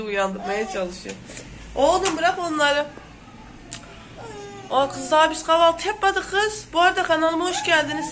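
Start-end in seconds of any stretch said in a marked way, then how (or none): 1.7-6.6 raised, higher scolding of the pets. Real, not acted. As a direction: shoo the cats off loudly. (kedileri yüksek sesle azarlayan kadın)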